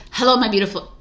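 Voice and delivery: normal talking voice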